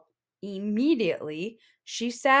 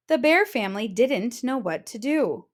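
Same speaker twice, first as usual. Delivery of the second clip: plain reading voice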